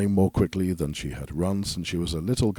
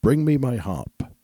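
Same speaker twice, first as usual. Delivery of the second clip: louder, higher ogre shout; 6 semitones higher, 4 dB louder than usual. A narrator's reading. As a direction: bark the order at his wife. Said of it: "the ogre shouted".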